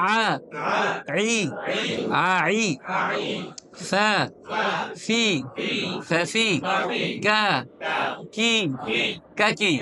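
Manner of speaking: short, separate syllables with lips spread wide, as in "বা, বি"; thin-letter articulation. Shown as a demonstration. Teacher drilling students on thin letters, lips spread. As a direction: spread the lips sideways on "তা, তি", keeping them light. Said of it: "পাতলা হরফগুলি জবর জের দিয়ে".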